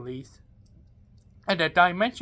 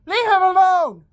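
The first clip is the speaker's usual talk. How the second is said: high, loud protective shout; high yell; 10 semitones higher, 5 dB louder than usual. Acted. Hero yelling at the villain to leave the boy alone, high shout. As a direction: cry out to stop the villain.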